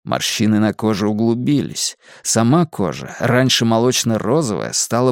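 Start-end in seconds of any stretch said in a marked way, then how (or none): none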